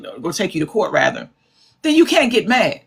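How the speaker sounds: normal talking voice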